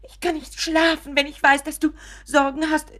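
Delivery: high, loud anxious begging; overwrought pleading. Acted. Mother implores Richard to share his worries, high anxious pleading. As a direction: plead as if losing him.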